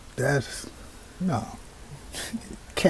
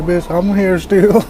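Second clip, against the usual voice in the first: much louder, higher, drawn-out taunt; loud mock ghost quote; 5 semitones higher, 10 dB louder, slower than usual. Viewer mocking the ghost in the video, loud and taunting. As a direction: blurt the ghost's line loudly as a joke.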